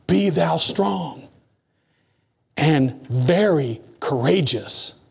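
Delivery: very slow, weighty verse; solemn slow recitation; slow scripture reading. Acted. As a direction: stretch each word of the verse. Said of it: "He said the only thing".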